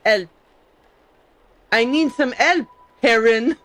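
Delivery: "help" without its H, "Aaron" with an added H; French h mix-up. Retold or imitated. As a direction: drop the H in help, put one before Aaron. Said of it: "they would say".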